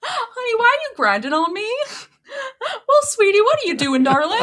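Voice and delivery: a Southern belle shooing off a pest. Pretend. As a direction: lift it way up, sugary and polite. Voicing [name] at party.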